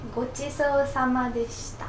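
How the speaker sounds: ごちそうさまでした said quickly as go-chi-so-sa-ma-de-shi-ta; Japanese phrase demo. Shown as a demonstration. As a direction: teach ごちそうさまでした in fluent Japanese. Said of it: "We say".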